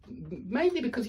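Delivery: usual speaking voice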